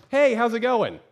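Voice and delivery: high, loud, overly bright hello; a loud, high, forced-casual greeting to a visiting friend; fake cheerful greeting.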